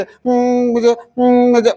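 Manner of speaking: high whimpering, half-sobbed words; mock crying child. Teacher imitating a crying child's nasal whimper, high pitched. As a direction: whine through fake tears. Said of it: "फिर रोते रोते भी करते".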